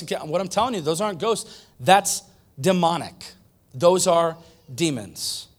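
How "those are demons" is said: ordinary talk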